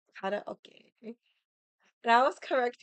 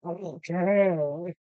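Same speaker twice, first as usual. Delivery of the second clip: mock Japanese okay; 3 semitones lower, 3 dB louder than usual. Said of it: "you're gonna say".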